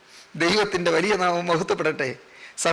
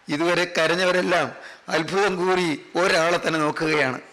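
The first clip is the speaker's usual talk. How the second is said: ordinary talk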